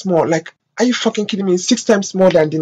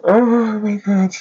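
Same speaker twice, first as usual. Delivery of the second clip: drawn-out, higher exclamation of distress; fighting back tears; 5 semitones higher, slower than usual. Real, not acted. Vlogger overwhelmed by Syrians' pain, fighting back tears. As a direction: let it out slowly, overwhelmed. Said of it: "I'm not gonna be crying".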